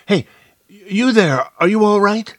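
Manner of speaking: high, loud call across a distance; calling over. A narrator's reading. Narrator calls to the person lying under the shrubbery, calling out. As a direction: shout out to someone a way off. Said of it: "I called over".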